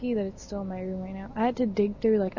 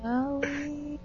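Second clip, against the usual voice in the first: slow, stretched mock threat; tattletale singsong; 3 semitones higher, 3 dB quieter, slower than usual. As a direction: draw it out like a playground tattletale. Playful tattling like a child.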